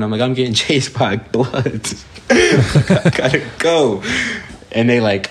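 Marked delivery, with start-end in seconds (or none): 0.2-4.0 higher and slower, laughing through the words; half-laugh, half-panic as you explain you're being chased. Retold or imitated. Fleeing, telling neighbors he's chased.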